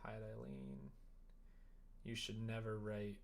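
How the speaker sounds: usual speaking voice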